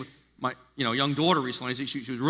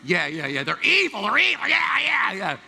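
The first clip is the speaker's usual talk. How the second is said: much higher, louder mock "they're evil"; hammed-up agreement; 4 semitones higher, 4 dB louder than usual. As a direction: overdo the eager agreeing. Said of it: "I'm like".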